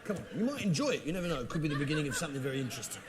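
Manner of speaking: ordinary talk, nothing marked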